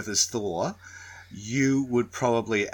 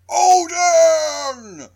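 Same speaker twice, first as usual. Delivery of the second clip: huge booming shout; 8 dB louder than usual. Retold or imitated. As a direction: bellow the name. Yelling the magic word.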